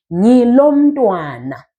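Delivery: "Ngilo mntwana" slow and drawn out; slow teacher's demo of "Ngilo mntwana"; model pronunciation. Shown as a demonstration. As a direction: stretch "Ngilo mntwana" for learners. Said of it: "you then say".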